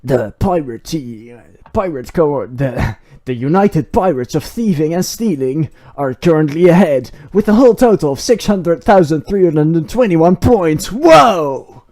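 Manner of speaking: loud, excited score update; excited announcer. Acted. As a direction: hype up the scoreboard.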